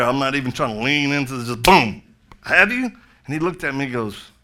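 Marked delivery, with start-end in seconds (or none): 2.4-2.9 raised, loud, pointed question; blunt challenge. Retold or imitated. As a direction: fire the question at him, high and loud. Confronts repairman with blunt salvation question.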